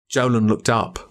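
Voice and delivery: plain reading voice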